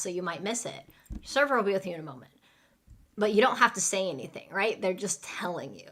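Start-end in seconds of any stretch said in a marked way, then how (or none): 1.1-2.3 rapid, run-together server line; so fast. Shown as a demonstration. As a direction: rattle it off so fast it could be missed. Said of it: "they say it so fast".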